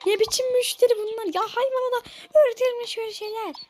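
exasperated outburst. Acted. Vet is shocked the pet learned Russian. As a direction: cry out in disbelief.

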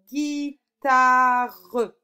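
loud, stretched syllables; sounded out. Shown as a demonstration. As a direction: break the word into beats for the pupil. Teacher sounding out the answer word. (mot découpé en syllabes appuyées)

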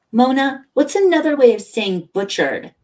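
high, loud call across rooms. A narrator's reading. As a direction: holler the question to your assistant. Boss calls assistant from inner office.

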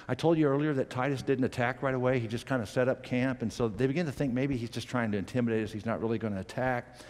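normal talking voice

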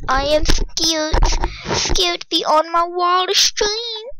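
high, drawn-out declaration of fear; playfully overdone fright; scared. Real, not acted. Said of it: "I'm, like, scared right now".

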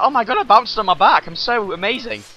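smug bragging. Retold or imitated. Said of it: "But then you'd be like".

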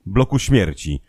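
usual speaking voice

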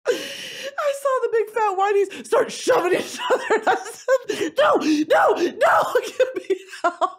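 high loud laughing cry; hammed-up laughing panic. Real, not acted. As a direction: shriek with laughter for help.

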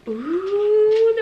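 pleasantly surprised ooh; delighted ooh. Real, not acted. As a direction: coo with delight. Finding a pouch.

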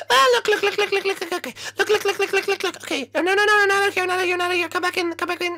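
very high, squeaky frantic chatter; frantic sidekick. Acted. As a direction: squeal repeatedly for attention as a tiny sidekick.

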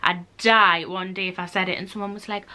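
ordinary delivery

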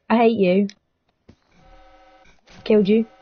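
ordinary talk, nothing marked

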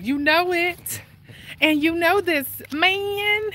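high, slow drawn-out hype line; Chris Tucker. Retold or imitated. Walking vlogger doing Chris Tucker for viewers, high and slow. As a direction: stretch it high like a comic catchphrase. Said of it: "I can't say it like Chris Tucker".

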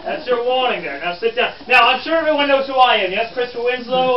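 scold, then announce yourself to the room; brusque 1863 reporter. Acted. Reporter introducing himself to his colleagues, brusque. Plays Christopher Winslow.

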